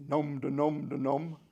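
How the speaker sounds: mock munching chant, slow; robot eating noise. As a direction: nom nom like a little robot eating. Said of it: "And when it eats".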